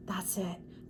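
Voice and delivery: whispered aside. Real, not acted. Reader whispering to herself while writing the message. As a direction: breathe it under your voice.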